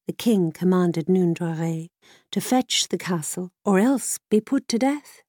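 plain reading voice

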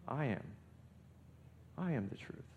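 soft, with a long pause between statements; quiet, deliberate answer, spaced out; hushed declaration. Retold or imitated. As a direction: let each statement land quietly. Voicing Jesus' imagined answer.

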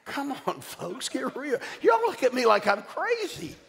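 preaching at full force, voice up high; high calling out. Real, not acted.

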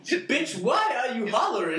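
mock screaming. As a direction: shriek at them across the restaurant. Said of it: "She starts screaming at you".

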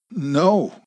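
high-pitched, emphatic no; insistent denial. A narrator's reading. Frightened hostage to gunman, insistent denial. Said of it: "Amy insisted".

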